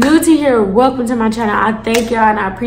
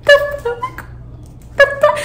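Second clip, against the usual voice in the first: high squeaky shocked the fuck; pitched way up in disbelief; stunned disbelief. Retold or imitated. She relives hearing police at the car window, squeaking in shock.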